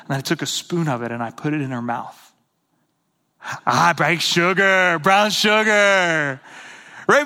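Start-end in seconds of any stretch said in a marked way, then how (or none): 3.6-6.4 loud, slow, drawn-out gleeful cry; hammed-up delighted exclamation; mock toddler delight. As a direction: imitate a child thrilled by the taste, stretching each word. Toddler finally tastes the brown sugar.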